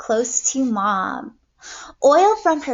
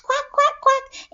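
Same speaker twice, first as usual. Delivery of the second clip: a high, lightly voiced quack, quack; 14 semitones higher than usual. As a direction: quack at the fox like a mother duck. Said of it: "and quacked".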